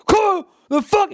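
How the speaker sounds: high, mocking road-rage holler; outraged high-pitched yell; imitated yelling. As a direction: mimic an irate driver yelling from his car. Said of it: "yell at me".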